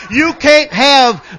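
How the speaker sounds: high, loud preacher's shout building in intensity; full-force preaching. Real, not acted. Preacher urging the church on the gifts, full-force preaching. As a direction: preach it out at the top of your voice.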